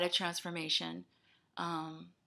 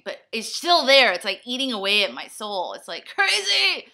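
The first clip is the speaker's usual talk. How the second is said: exasperated outburst; 3 semitones higher, 10 dB louder than usual. Real, not acted. Frustrated outburst about letting people down.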